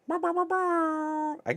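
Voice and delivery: high squeaky slowed effect voice; silly sound effect voice. Pretend. Jokey high sound effect voice to make up for no soundboard. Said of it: "no sound effects today".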